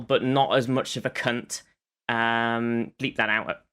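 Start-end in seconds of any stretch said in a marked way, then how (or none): none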